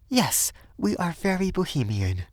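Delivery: high, light woman's voice; high, deliberate affirmation; bohemian young Frenchwoman. A narrator's reading. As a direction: agree in a high feminine voice.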